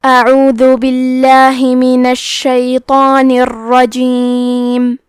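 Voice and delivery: slow, melodic Quran chant; measured Arabic recitation, every phrase drawn out; tajweed recitation. Acted. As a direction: recite the full passage in a long, sung tilawat. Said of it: "اب آپ ان آیتوں کی تلاوت تجوید کے ساتھ سماعت فرمائیں".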